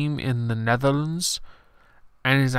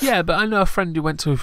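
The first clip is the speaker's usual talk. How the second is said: ordinary delivery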